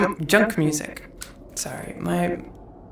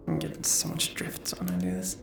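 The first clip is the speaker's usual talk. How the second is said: whispering; 13 semitones lower, 8 dB quieter than usual. Real, not acted. Frustrated aside about VR drift.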